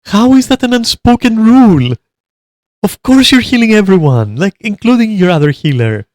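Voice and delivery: how could anyone not know; incredulous outrage. Real, not acted. Incredulous rant at video advice.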